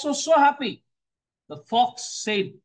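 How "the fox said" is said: usual speaking voice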